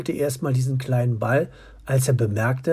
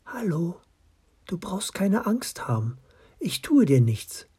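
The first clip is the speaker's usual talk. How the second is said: whispering; 5 semitones higher than usual. A narrator's reading. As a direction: whisper it gently. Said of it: "sprach es ganz leise an".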